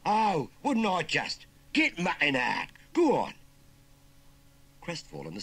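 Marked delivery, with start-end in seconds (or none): 0.1-3.3 loud, high Cockney bellow; bully him with loud commands; bullying order. Acted. Nephew ordering frightened uncle out, bullying shout.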